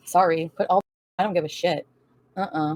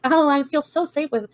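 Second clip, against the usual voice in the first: higher, slow mocking singsong of a smug woman; smug mock quote; 8 semitones higher than usual. Mocking Dee Dee being smug about feeling safe, slow and higher. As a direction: sneer it out like a smug girlfriend bragging. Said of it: "all day being smug and like".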